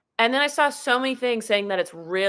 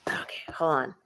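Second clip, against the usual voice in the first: whisper; 6 semitones lower, 7 dB quieter than usual. Real, not acted. Aside while fixing phone camera.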